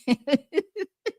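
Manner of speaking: real laugh, light and rising. Real, not acted. Teasing DJ Kid about his remark, laughing.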